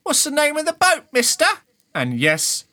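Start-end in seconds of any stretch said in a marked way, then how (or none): 0.0-1.5 high, loud cheeky urchin; chirpy kid calling up; street kid voice. Acted. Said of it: "they look at you and they go like".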